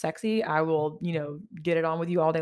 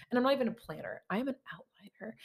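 ordinary talk, nothing marked

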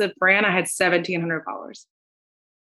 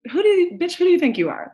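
high-pitched imagined telling-off; imitating an outraged caller; mock indignant outburst; 10 semitones higher than usual. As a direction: snap it as a hammed-up affronted client. Imagined angry phone call from client.

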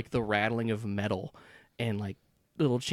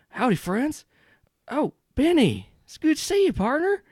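put-on beam like a tattooed cowboy glad to see an old friend; Southern cowboy voice; 14 semitones higher, 5 dB louder than usual. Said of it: "gruff Southern voice".

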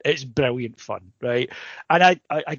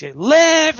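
hammed-up mad scientist shout, much higher and louder; mock Frankenstein yell; 12 semitones higher, 9 dB louder than usual. Director screaming at creation, hammed-up yell. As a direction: bellow it like a mad scientist at the tub. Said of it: "and he's".

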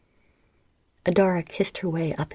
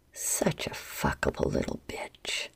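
much lower, slow throaty whisper; 14 semitones lower, 7 dB quieter than usual. A narrator's reading. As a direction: sink the voice down into the throat. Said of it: "her voice low and throaty".

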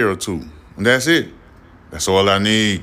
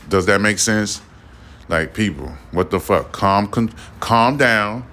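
usual speaking voice

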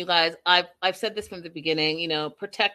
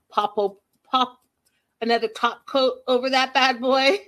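louder, higher, punchy pops; playful quick fix; 5 semitones higher, 5 dB louder than usual. Pretend. Showing how she could fix her nail, playful and punchy. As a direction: snap it out like a fast salon trick.

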